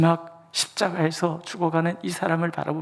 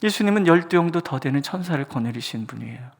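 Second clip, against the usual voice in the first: usual speaking voice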